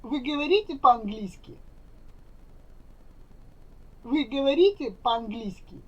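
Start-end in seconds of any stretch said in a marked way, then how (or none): none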